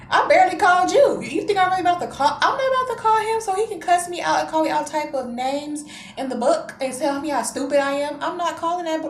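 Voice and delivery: high, loud protest; hammed-up outraged rant; indignant refusal. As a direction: re-enact arguing back at mom. Indignant refusal to call brother.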